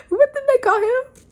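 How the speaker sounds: very high, squeaky-pitched question; incredulous high-pitched question; amused bafflement. Real, not acted. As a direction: ask it way up high, just out of a laugh. Baffled, laughing at the name.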